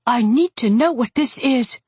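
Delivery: high, insistent voice; urgent demand. A narrator's reading. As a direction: demand an answer, pitched high. Dolly demands an answer.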